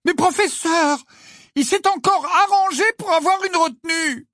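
high, loud indignant shout; loud, high-pitched protest. A narrator's reading. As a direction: shout your complaint to the teacher. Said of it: "se mit à crier si fort".

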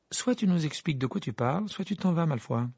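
a grumpy brush-off; bad-tempered. A narrator's reading. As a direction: tell him off without looking up from the book. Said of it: "avec mauvaise humeur".

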